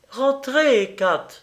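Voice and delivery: sharp order to come in, starting high; calling the dog. A narrator's reading. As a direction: call a dog back indoors. Calls the dog back inside.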